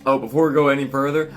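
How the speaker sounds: ordinary talk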